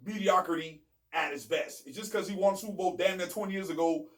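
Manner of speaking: normal talking voice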